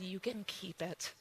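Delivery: hushed, settled "You can keep it"; soft, calm letting go. Retold or imitated. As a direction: quietly release it twice. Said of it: "I said".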